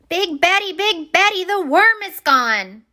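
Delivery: high, alarmed little fish crying out; cried out. Acted. Little minnow telling Big Betty the worm is gone, high alarmed cry. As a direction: call out the bad news in a panic. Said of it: "one of the little minnows cried".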